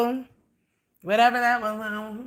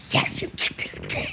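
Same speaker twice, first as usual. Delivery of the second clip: unvoiced, secret praise; sly whisper. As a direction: whisper it proudly behind their backs. Praising cat secretly after scolding.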